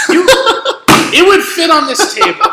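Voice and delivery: loud, very high protest; exasperated outburst. Real, not acted. Restaurant worker arguing against taking a bigger table, exasperated outburst. Exasperated argument amid laughter.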